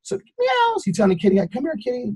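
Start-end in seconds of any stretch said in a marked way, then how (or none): 0.0-0.9 very high, thin meow; cat meow. Retold or imitated. Imitating a stray cat. 1.4-2.1 coaxing a kitty. Pretend. Coaxing a stray cat.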